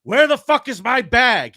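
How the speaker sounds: high, loud mock-angry shout; enraged sheriff demanding his bag; retold furious yell. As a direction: bellow it like a frantic sheriff. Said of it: "He's fucking losing his mind".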